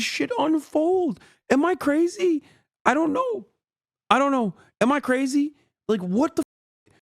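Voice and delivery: high, straining disbelief on the edge of cracking; pushed up so high the voice nearly cracks; voice cracking in exasperation. Real, not acted. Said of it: "Voice cracked?".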